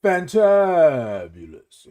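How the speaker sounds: Fantabulous drawn out very slowly; slow savoured Fantabulous; fantabulous with a spin. Shown as a demonstration. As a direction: stretch Fantabulous like a signature word. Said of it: "How do you feel this morning?".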